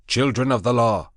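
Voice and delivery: high, loud commanding proclamation; proclaiming loudly. Acted. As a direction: lift the voice over the beasts. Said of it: "lifted up my voice".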